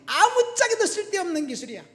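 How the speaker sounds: high, loud self-mocking outburst; loud comic dismay at oneself; exasperated exclamation. Real, not acted. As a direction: cry it out, then let pitch fall away. Exclaims about own useless old skills.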